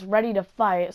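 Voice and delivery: ordinary talk, nothing marked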